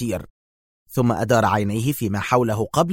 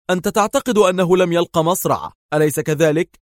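high, pressing question; Dar's tense high voice; 7 semitones higher, 3 dB louder than usual. A narrator's reading. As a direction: Dar asks Jer whether he survived, higher. Dar questions Jer.

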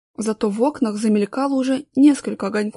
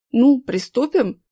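slow bossy order; commanding; 2 semitones higher, 4 dB louder, slower than usual. A narrator's reading. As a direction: lay down the order slowly. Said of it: "командовал третий".

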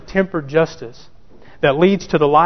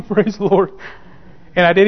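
usual speaking voice

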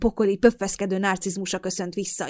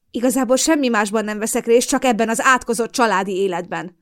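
sharp angry outburst, louder; snapping; 4 semitones higher, 6 dB louder than usual. Acted. Daughter snaps at her nagging mother, sharp and loud. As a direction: snap back at her, stung. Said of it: "csattantam fel".

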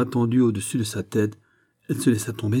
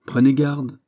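higher, louder bitter warning; bitter smile; 6 semitones higher, 8 dB louder than usual. A narrator's reading. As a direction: warn her through a bitter smile. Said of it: "avec un sourire amer". (avertissement au sourire amer)

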